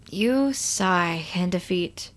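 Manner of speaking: slow, soft, sighing the words out; defeated sigh. A narrator's reading. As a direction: let the air go out of you. Narrator sighs defeated.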